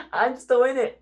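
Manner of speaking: real laughter at your own situation; amused disbelief, laughing it out. Real, not acted. Repeating it, louder, through laughter.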